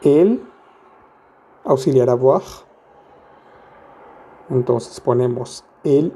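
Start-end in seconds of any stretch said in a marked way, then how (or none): none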